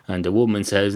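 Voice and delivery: ordinary delivery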